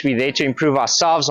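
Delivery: normal talking voice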